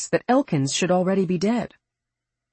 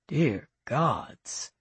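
softer, hushed in awe; awed; 2 semitones lower, 5 dB quieter than usual. A narrator's reading. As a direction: breathe it out in wonder. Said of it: "a note of awe suffusing his voice".

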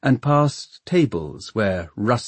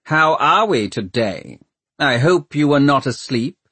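raised, hearty greeting; jolly bedside-style inquiry; almost cheery; 5 semitones higher, 4 dB louder than usual. A narrator's reading. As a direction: greet him loudly with forced cheer. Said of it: "sounding almost cheery".

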